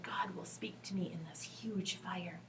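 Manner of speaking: quiet whispered hope; hushed hopeful whisper. Acted. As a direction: whisper it with wonder. Elijah hopes quietly.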